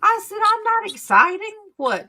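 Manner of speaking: ordinary delivery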